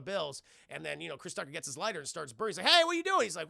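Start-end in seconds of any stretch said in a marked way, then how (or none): 2.7-3.2 loud, high-pitched mock objection; startled high yelp; alarmed protest. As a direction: cry out to stop someone, imitated. Retold alarm at burning money.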